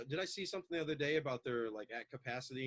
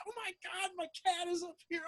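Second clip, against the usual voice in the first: much higher, flustered gasp about the cat; mock alarm. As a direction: play the old panicked self, a bit hammed. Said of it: "Before I was".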